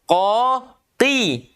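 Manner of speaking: ordinary talk, nothing marked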